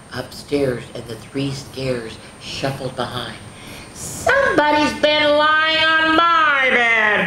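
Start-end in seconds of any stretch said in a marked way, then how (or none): 3.9-7.2 loud, slow, high-pitched boom. Acted. Papa finding his bed used, booming. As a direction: boom out every word slowly. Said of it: "boomed Papa".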